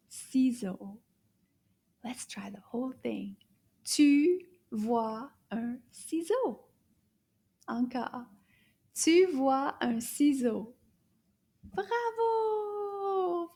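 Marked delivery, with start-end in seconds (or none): 2.0-3.4 quick whispered cue; fast voiceless prompt. Shown as a demonstration. Teacher whispers to the class to try it. 11.6-13.5 high puppet praise; puppet cheer. Pretend. Puppet Anana praising the class.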